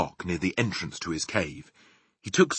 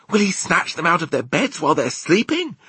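put-on high, louder, alarmed child character; horrified question; 12 semitones higher, 5 dB louder than usual. A narrator's reading. Sophie asks the giant about sleeping children, horrified.

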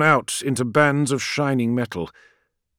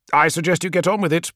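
higher, hurried command; urgent prodding; 5 semitones higher, faster than usual. A narrator's reading. Call hurrying Friedrich as missiles blaze past, urgent. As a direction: rush it out under pressure. Urging crewman as missiles pass.

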